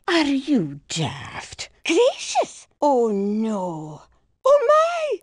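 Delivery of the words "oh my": startled gasp. Acted. Startled exclamation.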